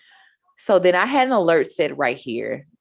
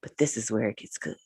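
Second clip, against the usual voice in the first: soft, lowered voice, trailing quieter; hushed talk; 5 semitones lower, 11 dB quieter than usual. Real, not acted. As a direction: drop to a low, confiding hush. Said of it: "why I'm talking like this".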